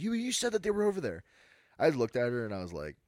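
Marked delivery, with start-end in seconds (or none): 0.0-1.2 higher, accusing complaint; indignant customer. Retold or imitated. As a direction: scold the clerk for misleading her. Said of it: "And she's".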